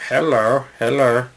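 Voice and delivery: badly faked Mexican accent on a greeting. Comic showing a deliberately wrong accent, bad Mexican accent. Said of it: "Here's my Mexican accent".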